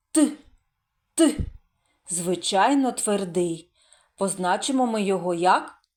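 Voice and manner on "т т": short isolated hard "Т"; hard consonant. Shown as a demonstration. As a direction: pronounce "Т" alone to show it is hard. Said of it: "А твердий чи м'який?". (уривчастий твердий звук "Т")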